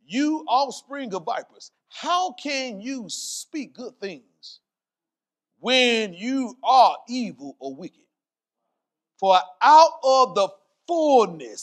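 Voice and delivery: slow, weighty preacher's declamation; forceful scripture reading. Real, not acted. Preacher reads the Amplified verse to congregation, forceful declamation. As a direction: proclaim the verse, stretching out the words.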